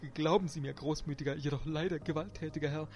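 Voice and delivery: high, frightened stammering seller; chattering-teeth fear. A narrator's reading. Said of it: "Klappernden Gebisses klackte der Verkäufer".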